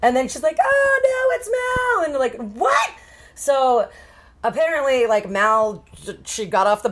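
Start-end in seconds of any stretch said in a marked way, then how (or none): 0.6-2.1 very high, hammed-up wail of alarm; mock panicked cry. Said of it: "she's panicking". 2.6-2.9 very high, rising yelp; mock shock. Acts parents' shocked reply.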